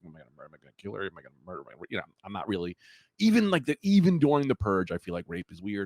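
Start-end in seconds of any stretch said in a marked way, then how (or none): 0.0-1.7 much softer, lower, rushed mumble; muttered deliberation. Retold or imitated. Purge-goer debating with himself, muttered low and fast. Weighing killing her, to himself.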